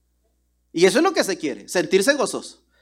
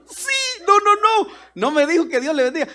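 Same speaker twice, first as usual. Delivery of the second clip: surprised; 10 semitones higher, 4 dB louder than usual. Real, not acted. Preacher expects a blessing.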